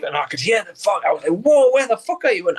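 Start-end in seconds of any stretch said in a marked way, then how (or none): 1.4-2.5 a touch of alarm at the noise; surprised shout. Retold or imitated. Hearing noise on the phone.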